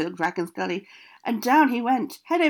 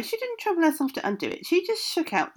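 plain reading voice

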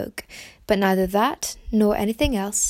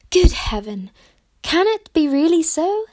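high, louder outburst of amazed joy; astonished cry; 7 semitones higher, 4 dB louder than usual. Acted. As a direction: cry it out, half thrilled and half doubting. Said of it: "cried Jane".

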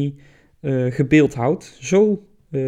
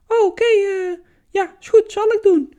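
put-on naive girl voice; 3 dB louder than usual. Proserpina answers Pluto, naive.

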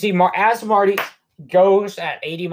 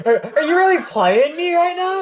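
high-pitched, stammered disbelief; squeaky mock indignation; mock incredulous; 12 semitones higher than usual. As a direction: imitate someone outraged at being fooled. Marty reacts to Doc being alive.

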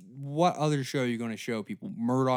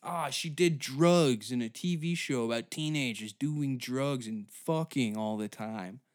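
ordinary talk, nothing marked

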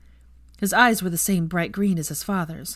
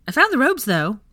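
a hint of playful Ginny; 7 semitones higher, 6 dB louder than usual. Ginny playful in Auror robes.

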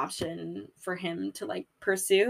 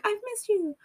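high, sweet mock voice; sugary reunion; 13 semitones higher than usual. Friends making amends, high cooing. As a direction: coo it at a long-lost friend. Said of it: "They're like".

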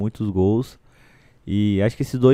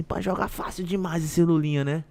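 fan voice; 6 semitones higher, 4 dB quieter than usual. Retold or imitated. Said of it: "ele virou pra galera e falou assim".

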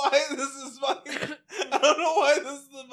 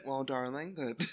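lower, softer prim mother voice; mock prim mother, lower and hushed; mother's voice; 9 semitones lower, 6 dB quieter than usual. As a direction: play the posh mummy gently explaining. Voicing mother in retold story.

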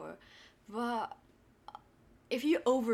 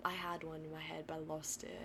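whispering; 3 semitones lower, 8 dB quieter than usual. Real, not acted. Whispered aside, forgot idea.

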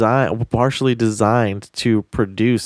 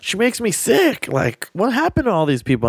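upset mom; 11 semitones higher than usual. Retold or imitated. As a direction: mimic mom being disgusted and upset. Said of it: "she was just all upset about it".

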